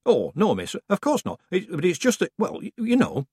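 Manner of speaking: high, fumbling and caught out; flustered village woman. A narrator's reading. Woman caught with a prayer paper, flustered and high. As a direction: stammer like you've been caught. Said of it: "suddenly looked flustered".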